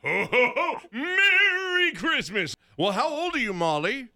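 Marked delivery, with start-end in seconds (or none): 0.0-2.5 slow, high Santa shout; hearty Santa greeting; jolly ho ho ho. Acted.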